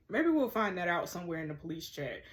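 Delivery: ordinary talk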